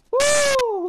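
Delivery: very high triumphant woo; high joyful whoop; relieved whoop. Real, not acted. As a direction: cheer out the relief. Escape success.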